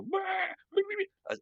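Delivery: high bleating bah sounds; nervous bleating. Retold or imitated. Friends nervous around him, high mock bleating. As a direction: bleat it out like jittery nerves. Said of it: "our nerves go".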